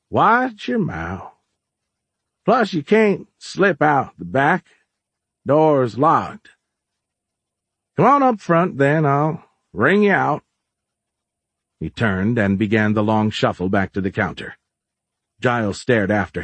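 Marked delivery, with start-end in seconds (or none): 0.1-10.4 put-on high, slow old-timer's drawl; folksy shopkeeper, in charge. Plays Orlin scolding.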